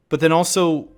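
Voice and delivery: ordinary talk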